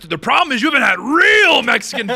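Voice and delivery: mock shout. Ranting about ethnic food critics.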